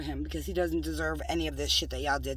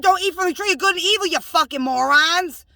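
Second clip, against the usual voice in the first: loud, high-pitched rebuke; shouting God's command in outrage; angry god voice; 9 semitones higher, 9 dB louder than usual. Retold or imitated. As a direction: yell it down at the morons. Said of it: "he said".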